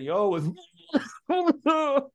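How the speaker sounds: high squeaky greeting broken by a laugh; laughing while imitating his drunk self; laughing drunk reply. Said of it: "And I was like".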